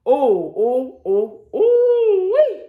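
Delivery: high squealing ooh wee; excited whoop. Acted. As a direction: let out a high joyful whoop.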